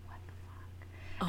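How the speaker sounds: slow whispered disbelief; stunned whisper. Retold or imitated. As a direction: whisper it, frozen in shock. Chili's stunned reaction, retold.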